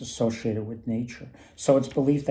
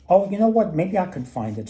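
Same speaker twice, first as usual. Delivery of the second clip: higher and louder elf offering help; eager little elf, pitched up; 7 semitones higher, 5 dB louder than usual. Retold or imitated. Elf offers to find the axe, high and eager.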